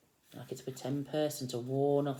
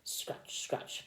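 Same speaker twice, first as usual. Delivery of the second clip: hoe noise; 3 dB quieter than usual. Retold or imitated. Imitating the hoe's noise.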